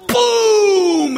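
loud, high boom sound; imitated explosion. Preacher mimicking the flash pot, loud boom.

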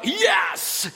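high, punchy mock cheer; triumphant cheer. Jonathan celebrating the invitation to climb, triumphant. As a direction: cheer as if the sign just came. Pair celebrate getting their sign.

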